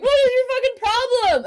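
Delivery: very high exasperated crowd voice; mock outrage. Fans in line rounding on her, mock outrage.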